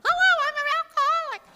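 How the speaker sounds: very high, loud, fast gushing; thrilled shriek. Retold or imitated. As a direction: squeal with joy like a starstruck fan. Greeting pictures in the cabin.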